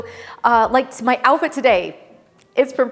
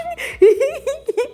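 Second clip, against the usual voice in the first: giddy laugh; 3 dB louder than usual. Real, not acted. Giddy at the store.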